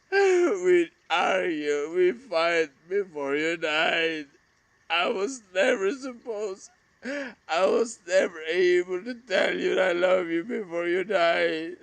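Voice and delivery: anguished crying to a dead brother about unspoken love. Real, not acted. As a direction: cry it out through tears. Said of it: "this video became so emotional".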